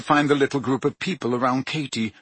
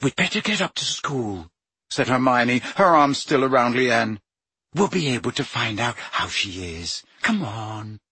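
gentle comfort; 2 semitones lower than usual. A narrator's reading. Hermione comforting weeping Leanne, gently. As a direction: coax her along gently.

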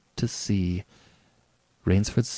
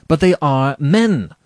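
loud, higher indignant objection; hot protest; 7 semitones higher, 8 dB louder than usual. A narrator's reading. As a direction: protest hotly, stressing the last words. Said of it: "said Rainsford hotly".

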